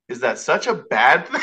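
laughing through the words. Real, not acted. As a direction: ask it while cracking up. Joking about being typecast as God.

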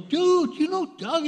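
high, drawn-out disbelieving question; imitated astonished friend; incredulous. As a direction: act out an amazed buddy, voice shooting up.

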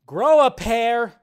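loud, slow, mock-commanding; exasperated shout. Real, not acted. Ranting vlogger shouting at squeamish fans, loud and slow. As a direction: bark it at the whiners.

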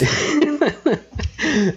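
high-pitched burst of laughter. Real, not acted. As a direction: let out a high cackle. Laughs at his prediction.

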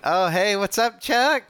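imitating a cheery greeting; casual greeting impression. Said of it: "he's like".

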